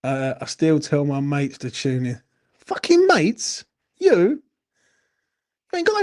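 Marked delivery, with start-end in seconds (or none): 2.6-6.0 much higher, slow, stretched-out mock incredulity; mock disbelief. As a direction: scoff at the idea that Alf has mates, slowly and high. Mocking Alf's claim of mates.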